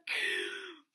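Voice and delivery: sharp high gasp, hammed up; mock shocked gasp. Mock gasp at the classmate's insult. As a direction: gasp as if offended. Classmate says she looks like shit.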